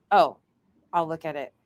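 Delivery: ordinary talk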